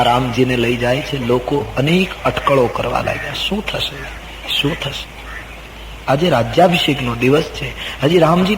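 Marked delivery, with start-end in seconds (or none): 3.0-5.2 hushed, wondering crowd murmur; hushed speculation; anxious murmur. Acted. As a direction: murmur it softly like uneasy onlookers.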